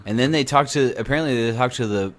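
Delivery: usual speaking voice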